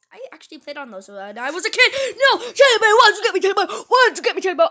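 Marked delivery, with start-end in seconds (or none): 2.2-4.7 high, loud play-yelling at a toy; mock outraged yell. Player to the teddy bear that got him, mock outraged yell. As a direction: yell in exaggerated protest, pitch up.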